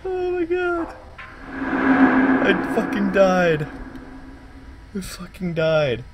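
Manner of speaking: high, slow words through laughter; giggling with relief after the fright; laughing in disbelief. Real, not acted.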